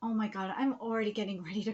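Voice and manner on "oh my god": usual speaking voice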